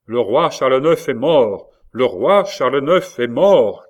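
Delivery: loud, slow herald's announcement; proclamation cry. A narrator's reading. Captain announces the king's death from the balcony, crying out. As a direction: proclaim it to the whole courtyard. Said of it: "cria-t-il trois fois".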